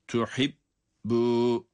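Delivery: "tuhibbu" with a silent stop on the first b; slow chanted "tuhibbu" with a doubled b; voiceless hold on B. Shown as a demonstration. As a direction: close the lips silently on "tuhibbu", then release the long u. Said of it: "ilk bölümde yine ses vermiyoruz".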